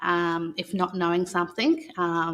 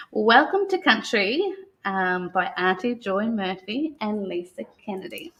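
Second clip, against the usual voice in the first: put-on reading to children. [name] reads the Welcome to Country book to the children, in a calm storytelling voice. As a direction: read it aloud like a welcome ceremony to young listeners.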